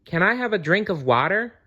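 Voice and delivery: put-on a small child asking sweetly. A narrator's reading. Sally asking her father for water, lightly voiced child.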